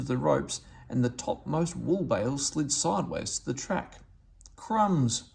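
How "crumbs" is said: burst out. A narrator's reading. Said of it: "burst out Willy".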